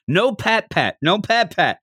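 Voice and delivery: nagging him to stop, half-played; mild mock scolding. Host imitates Bulma scolding Goku, mild mock scold. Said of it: "she's yelling at him".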